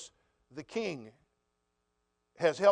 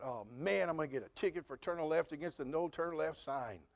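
softly, a dismayed thought to himself; quiet inner groan; 2 semitones lower, 10 dB quieter than usual. Retold or imitated. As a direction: mutter the dread of a ticket under your breath. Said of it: "and I thought".

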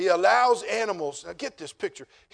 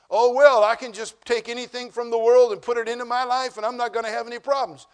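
mock-carefree, louder and higher; mocking careless Christians; 5 semitones higher, 8 dB louder than usual. Preacher mocks careless Christians, a hammed-up carefree voice. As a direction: sneer at the worldly believer's excuse. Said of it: "they're thinking".

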